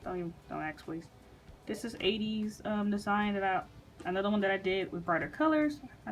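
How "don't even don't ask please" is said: sluggish, low and drowsy; groggy. Real, not acted. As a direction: talk like you just woke up heavy. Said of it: "sorry if I sound groggy".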